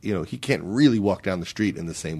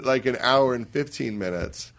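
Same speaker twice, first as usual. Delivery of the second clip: ordinary delivery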